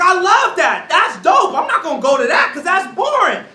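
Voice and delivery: gush, then brush it off; hyped student. Retold or imitated. Student gushing over fun, scorning the boring, loud. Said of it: "So you'd be like".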